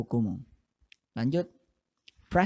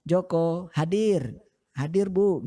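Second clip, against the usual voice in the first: student answering roll; 5 semitones higher, 3 dB louder than usual. Retold or imitated. Pupil answering the teacher's roll call. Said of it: "Atau kalau kita dalam bahasa Indonesia".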